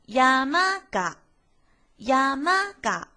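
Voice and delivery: 「山が」山高后が降低，慢读; 读山后在が上降下来; 二调接が降调. Shown as a demonstration. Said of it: "在二调的山后面接が". (「山が」二调，が降调)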